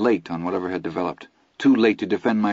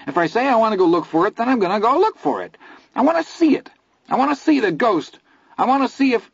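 much higher, fast, struggling demand; frantic insistence; 4 dB louder, faster than usual. A narrator's reading. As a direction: yell it while wrestling free.

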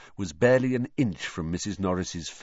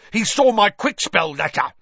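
high, loud, frantic put-on voice; furious accusation; 13 semitones higher, 7 dB louder than usual. A narrator's reading. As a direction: hurl the accusation at the boy. Filch keeps accusing Harry.

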